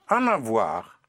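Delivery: ordinary delivery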